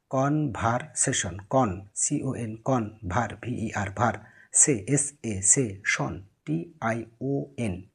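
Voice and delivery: slow, each syllable of conversation split and spelt. Shown as a demonstration. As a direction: break conversation into কন, ভার, সে, শন slowly. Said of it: "syllable ভেঙে ভেঙে পড়লে". (ধীরে ধীরে syllable ভেঙে ভেঙে পড়া)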